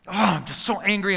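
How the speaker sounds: high, worked-up venting; fired-up anger. Retold or imitated. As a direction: act out fuming after a road fight. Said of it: "I'm so mad, and I'm so fired up".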